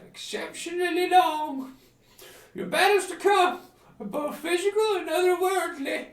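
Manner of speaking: put-on high, loud theatrical old oracle; mystic hermit seer. Hermit reading the adventurer's fate, high and loud. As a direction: intone the prophecy like a prissy seer.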